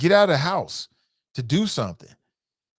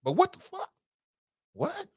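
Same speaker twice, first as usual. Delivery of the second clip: much higher, outraged question; shocked disbelief; 4 dB quieter than usual. Real, not acted. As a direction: still floored by the comment. Shocked reaction to the comment.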